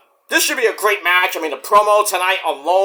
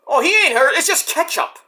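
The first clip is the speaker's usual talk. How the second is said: lightly higher, breezy brush-off; mock reassurance; 8 semitones higher than usual. Mother telling her kids it's only ketchup, lightly higher. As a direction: wave it away like nothing happened. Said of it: "Bree's like".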